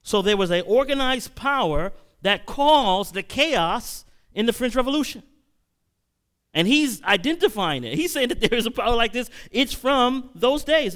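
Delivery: loud, rising sermon voice; preacher building to a loud peak; preaching at full force. Acted. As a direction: drive it home to the congregation. Preacher raises voice to congregation.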